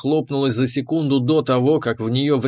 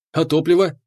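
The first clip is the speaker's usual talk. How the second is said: ordinary delivery, nothing marked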